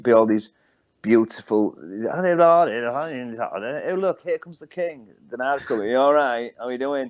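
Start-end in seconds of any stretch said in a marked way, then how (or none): 1.8-5.1 put-on high, proper middle-class stage accent; lilting, high-pitched theatrical proper accent; flowing posh drama-school accent. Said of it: "they just had these wonderful flowing accents". 5.9-7.1 put-on everyday rough-as-toast Hull accent; unpolished Hull accent, casual greeting. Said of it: "rough as toast".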